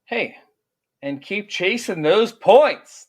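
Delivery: hyped sign-off. Real, not acted. Hype sign-off.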